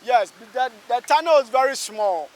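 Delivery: ordinary delivery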